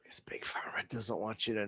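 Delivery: hushed conspiratorial whisper. Real, not acted. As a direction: let it slip out in a whisper. Conspiratorial aside.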